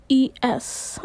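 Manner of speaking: ordinary delivery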